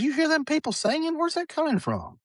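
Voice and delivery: very high-pitched retold granny question; wondering grandmother. Granny hears singing nobody else hears.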